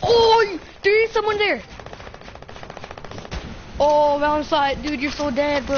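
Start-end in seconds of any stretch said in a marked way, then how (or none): 0.0-1.6 excited. Real, not acted. Spotting an enemy mid-game.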